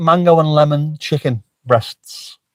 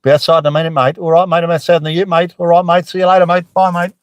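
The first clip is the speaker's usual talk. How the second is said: lightly voiced daughter in broad Aussie, chirpy; 5 semitones higher than usual. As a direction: toss off each mate Aussie-style, quick and breezy. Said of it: "she fires back in very, very Aussie".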